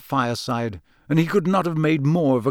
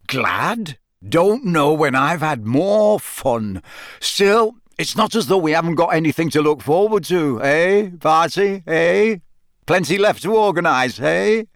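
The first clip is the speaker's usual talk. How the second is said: jovial, boisterous official; 3 semitones higher, 4 dB louder than usual. A narrator's reading. Bagman teasing Barty about fun ahead, jovial. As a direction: nudge him along with jolly "eh"s.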